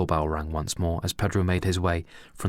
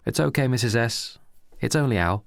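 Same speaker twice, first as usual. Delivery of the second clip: higher, mock-reassuring call; sarcastic shout; 5 semitones higher than usual. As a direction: reassure with a sneer. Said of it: "shouted Reese sarcastically".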